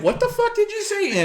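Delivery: high incredulous yell with a laugh; hammed-up disbelief; mock baffled outrage. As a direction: laugh through a mock outburst. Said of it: "fine line of".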